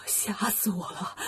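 soft, slow, shaken murmur; frightened. Acted. Tang Seng to his disciples after escaping robbers, shaken and soft. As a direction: barely get the words out after a fright.

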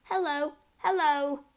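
high, carrying parrot hellos; loud, clear parrot. A narrator's reading. Parrot heard across the hall, high clear calling. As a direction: call clearly from another room. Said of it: "a voice came loud and clear".

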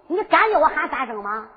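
usual speaking voice

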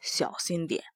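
low hushed whisper; whispered warning. Acted. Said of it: "突然压低了声音".

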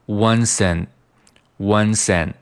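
"once and" joined as wun-sn; linked weak "and". Shown as a demonstration. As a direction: slide "once" into a reduced "and". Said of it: "once和and连读". (连读并弱读and)

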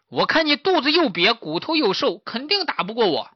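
high-pitched, defiant piglet voice; bold piglet. Acted. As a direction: talk tough to a scrawny opponent. 小猪向老虎示威. (小猪高声逞强)

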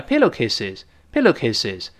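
"pillowcases" quick, final sound nearly an s; read quickly. Shown as a demonstration. Teacher shows the z vanishing at speed, quick "pillowcases". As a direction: say "pillowcases" fast, ending barely voiced. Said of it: "读快了".

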